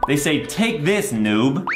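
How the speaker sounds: normal talking voice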